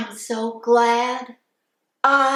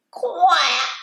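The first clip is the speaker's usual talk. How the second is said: high quacking duck call; 6 semitones higher, 6 dB louder than usual. Acted. Teacher quacking like a duck for kids, high. Animal noises in barnyard song.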